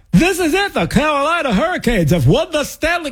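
high-pitched mock sports announcer, nose blocked; imitating an announcer with a blocked nose; clogged-nose announcer. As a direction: race through it high and stuffed-up. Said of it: "sound always like they have a clogged nose".

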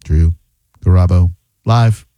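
DREW, GARABO, LIVE split by pauses, each firm and low; word-by-word delivery. Real, not acted. Host answering the phone, each word set apart.

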